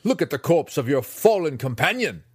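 loud, high furious tirade; shouting. Acted. Master raging at Drizzt over the dead student, shouting. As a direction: rage at him, slowing for weight. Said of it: "shouted the master".

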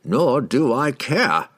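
high, slow, dismissive amusement; lightly mocking wizard voice; amused wizard. A narrator's reading. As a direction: toss it off as if amused, drawn out. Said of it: "laughed the wizard".

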